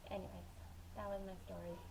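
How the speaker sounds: ordinary talk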